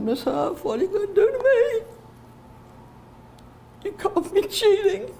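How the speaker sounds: high, upset little pupil's plea; pleading child. Retold or imitated. Retold child pleading after being caught.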